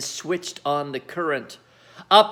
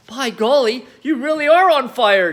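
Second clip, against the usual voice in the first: put-on amazed boy; 7 semitones higher, 6 dB louder than usual. A narrator's reading. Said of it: "George said".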